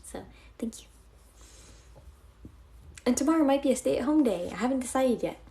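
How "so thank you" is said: softly whispered thanks. Real, not acted. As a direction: whisper your gratitude to the viewers.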